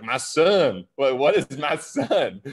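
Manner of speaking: slow Southern drawl, drawn out; exaggerated Southern accent, puzzled. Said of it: "like, a Southern accent".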